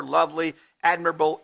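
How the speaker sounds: usual speaking voice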